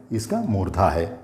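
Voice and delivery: ordinary talk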